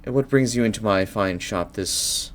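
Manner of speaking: soft, slow, brooding bookseller; hushed weary old shopkeeper; mysterious shopkeeper. Acted. GM plays Veiled Page shopkeeper.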